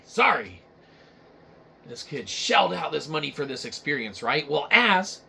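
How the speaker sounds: usual speaking voice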